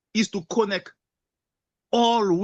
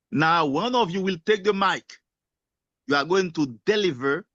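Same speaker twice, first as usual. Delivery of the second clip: normal talking voice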